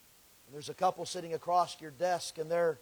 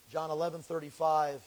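normal talking voice

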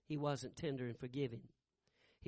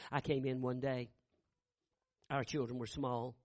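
normal talking voice

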